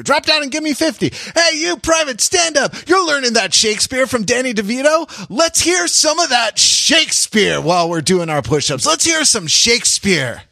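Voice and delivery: high barked drill sergeant shout. Retold or imitated. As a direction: yell orders at the recruits. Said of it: "the stereotypical drill instructor".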